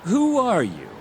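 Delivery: high-pitched, startled demand; alarmed challenge. Acted. Club staffer confronting visitors asking about a dead member, alarmed challenge. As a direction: demand to know who these people are.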